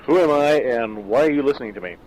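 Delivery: ordinary delivery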